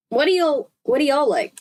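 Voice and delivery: a hint of a Southern twin asking the group; Southern twang imitation. A narrator's reading. Chuchi asks Aqua what they like, in a light Southern accent. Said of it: "imitating the twins' accent".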